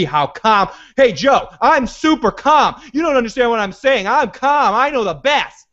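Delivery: high, loud smug mockery of a calm arguer; hammed-up smug know-it-all in a heated argument. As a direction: sneer "I'm calm" while clearly worked up. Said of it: "I hate when I argue with somebody".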